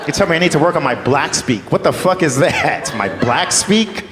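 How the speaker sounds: ordinary delivery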